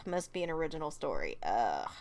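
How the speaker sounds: fed-up sigh; weary groan. Real, not acted. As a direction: sound dragged into it. Sighing at request.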